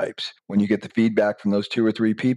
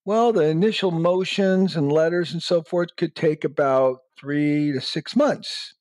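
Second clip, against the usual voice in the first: higher, slow, lawyerly explanation; 7 semitones higher, slower than usual. Pretend. Lawyer telling the client the timeline, higher and slow.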